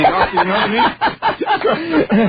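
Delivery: high, slow, laughing through it; dissolving into laughter. Real, not acted. Finishing the rat story to a friend, laughing.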